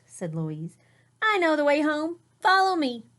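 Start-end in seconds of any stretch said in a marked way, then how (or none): 1.2-3.1 confident sister. Acted.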